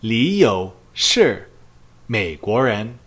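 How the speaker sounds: slow Mandarin, "Li" stressed, falling close; unhurried "Li You shi Meiguoren"; slow model answer. Shown as a demonstration. Teacher models the answer slowly for students. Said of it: "the affirmative answer would be".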